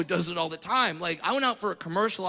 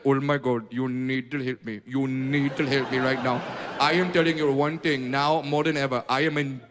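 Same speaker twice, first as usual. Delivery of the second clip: lightly put-on Indian accent, lower, pleading; mock Indian accent; 8 semitones lower than usual. Indian friend begging for computer help, lightly mocking Indian accent. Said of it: "your Indian friend just called you like".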